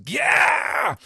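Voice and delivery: very high, loud yelp; Caribou voice. Acted. Caribou cries out as the ship is lifted, high loud yelp. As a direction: squawk it out like a cartoon. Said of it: "a voice which belongs to Caribou's".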